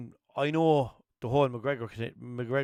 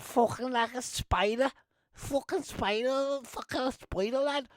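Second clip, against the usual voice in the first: high, slow attempt at a Liverpool accent; a self-confessed bad Scouse impression, stretched out; 13 semitones higher, slower than usual. Fighter's post-fight boast, in a shaky Scouse accent. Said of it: "I'd love to be able to do accents, but I can't do Liverpudlian".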